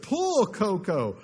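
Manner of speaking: a farmer coaxing his horse, slow then a high holler; encouraging call. Acted. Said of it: "the farmer encouraged".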